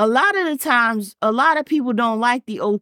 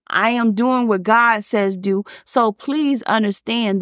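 ordinary talk

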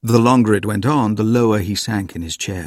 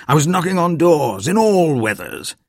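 put-on higher, boastful old man; Grandpa voice; 8 semitones higher, 3 dB louder than usual. A narrator's reading. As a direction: brag about the hard old days.

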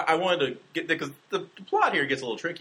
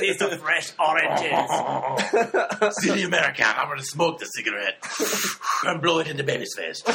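mock French accent; 3 semitones higher than usual. Shown as a demonstration. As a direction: play a cartoon Frenchman riffing. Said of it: "read it in a French accent".

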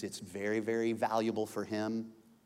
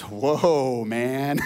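awed whoa; 9 dB louder than usual. Retold or imitated. Adam sees naked Eve.

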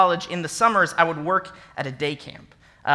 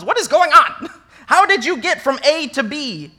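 exasperated; 7 semitones higher, 6 dB louder than usual. Retold or imitated. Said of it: "Paul looks at the Galatians and says".

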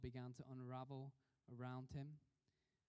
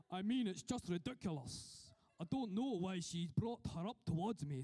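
loud, put-on Scottish accent; Gordon Brown's Kirkcaldy accent; 7 dB louder than usual. Preacher quoting the politician's reply to his aide, loud Kirkcaldy accent. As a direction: complain loudly in a mock Kirkcaldy accent. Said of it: "This is my best Kirkcaldy accent".

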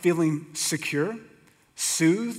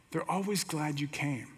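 softer recital of a theme-song line; softly spoken lyric; 7 dB quieter than usual. Retold or imitated. Preacher repeating the Cheers lyric to himself, softly spoken. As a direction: say the lyric quietly, without stretching it.

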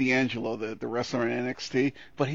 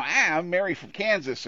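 mock Kansas accent; 6 semitones higher, 3 dB louder than usual. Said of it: "erasing your regional accents".

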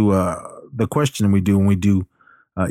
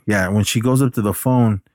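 ordinary talk

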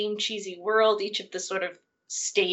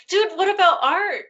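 high-pitched, incredulous mock plea; hammed-up indignation, pitched well up; mock protest; 9 semitones higher, 4 dB louder than usual. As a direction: protest playfully on a forgotten friend's behalf. Acted protest on a slighted character's behalf.

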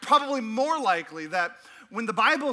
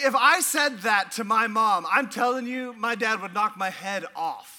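usual speaking voice

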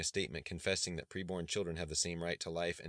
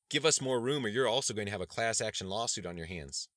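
loud, raised-pitch ultimatum; demanding threat; 5 semitones higher, 7 dB louder than usual. A narrator's reading. Student leader threatening police with a lawsuit, demanding. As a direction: push the demand hard and loud.

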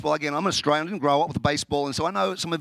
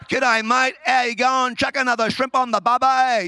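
mock Australian accent, raised pitch and louder; hammed-up tourist's attempt at Australian; 5 semitones higher, 3 dB louder than usual. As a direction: badly imitate an Aussie accent. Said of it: "trying to do an Australian accent".

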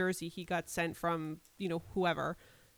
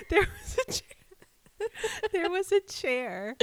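high, slow words squeezed out through laughter; real giggling fit; laughing while talking; 11 semitones higher, slower than usual. Real, not acted. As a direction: barely get it out for laughing. Laughing while telling fall story.

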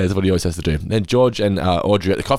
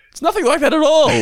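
shrill-pitched outburst, much higher and louder; high mock shout; 4 dB louder than usual. Host mocking the bragging claim, high mock shout. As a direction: yell it in mock outrage.

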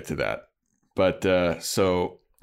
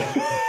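high squeal laugh. Real, not acted. Laughing at own quote.

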